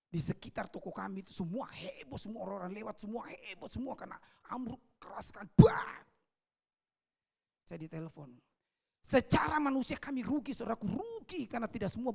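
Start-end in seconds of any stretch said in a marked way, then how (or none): 5.5-6.0 a loud, high "brak!" of the crash; vocal crash effect, pitched up high; high crash imitation. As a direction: squeal out the impact of the falling canopy. Canopy crash imitated again.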